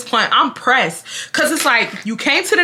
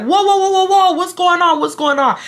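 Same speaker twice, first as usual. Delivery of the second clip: higher, hurried intervention; imitated alarmed dad; 7 semitones higher than usual. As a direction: step in to break up a fight. Dad stepping into the quarrel.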